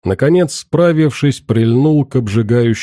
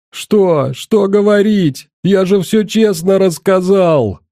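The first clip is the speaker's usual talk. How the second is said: higher, panicky pleading cry; frightened wail; 7 semitones higher than usual. A narrator's reading. Terrified boy denying to his captor, pitched-up frightened cry. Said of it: "заикаясь, испуганным голосом вопил пацан".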